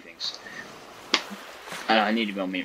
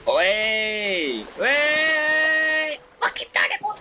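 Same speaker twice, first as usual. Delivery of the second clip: much higher, whooping victory; triumphant cheer; much slower than usual. Real, not acted. Gamer finally making the purifier, triumphant cheer. Celebrating a success.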